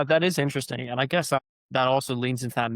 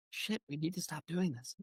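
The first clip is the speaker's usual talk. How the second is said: much softer, under the breath, slightly higher; hushed mock resolve; 3 semitones higher, 12 dB quieter than usual. Characters reacting to getting caught again, hushed mock resolve. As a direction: drop to a small, furtive voice.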